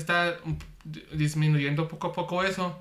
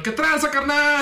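a hint of a higher, louder dubbing-actor voice; Spanish dub voice; 9 semitones higher, 5 dB louder than usual. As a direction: lightly mimic a Mexican dub line, pitched up. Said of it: "Y luego en español".

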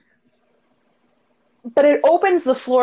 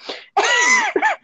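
shrieking laugh. Real, not acted. Laughing at friend's crude drawings.

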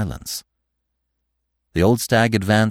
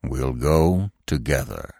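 low, slow, measured authority; commanding; 7 semitones lower, 3 dB quieter, slower than usual. A narrator's reading. Old stag taking charge of Bambi, low and commanding. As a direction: declare it deep and deliberately. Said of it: "in a commanding voice".

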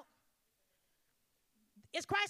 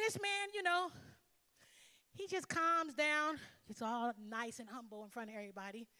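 ordinary talk, nothing marked

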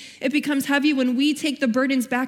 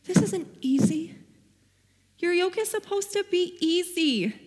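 higher, exasperated protest; frustrated complaint; 7 semitones higher than usual. Retold or imitated. Reading the verse and protesting to God, higher exasperated. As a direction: push back like it's unfair. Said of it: "I was like".

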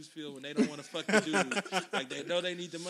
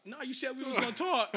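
put-on higher, louder kid's objection; pleading protest; 7 semitones higher, 5 dB louder than usual. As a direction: protest to dad in a raised voice. Kid protesting to dad.

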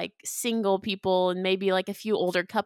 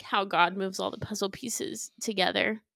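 usual speaking voice

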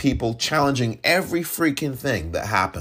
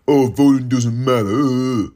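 slow, louder mock whine; mocking quote; 5 dB louder than usual. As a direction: drag it out like a lazy excuse. Mocking those who say voting doesn't matter.

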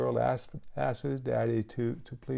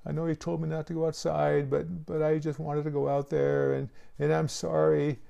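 higher-pitched pleading child, mildly imitated; retold tearful apology in a raised voice; crying little girl; 5 semitones higher than usual. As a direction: plead like a sorry child, lightly acted. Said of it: "And she's crying. She says".

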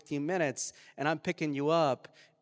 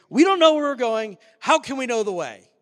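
high, loud panicked outburst; Thomas, frantic; 7 semitones higher, 13 dB louder than usual. Acted. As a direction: cry out in dramatized confusion. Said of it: "I think Thomas is pretty frantic".

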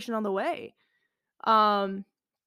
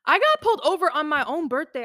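loud, raised exclamation; worked-up exclamation; 5 semitones higher, 9 dB louder than usual. Real, not acted. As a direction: tell it with loud disbelief. Telling of being pulled over.

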